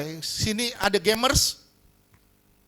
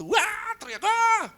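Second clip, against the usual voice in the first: mock tantrum scream; slower than usual. Shown as a demonstration. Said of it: "Tantrum itu".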